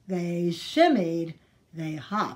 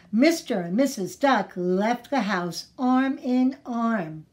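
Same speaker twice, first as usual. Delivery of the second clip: plain reading voice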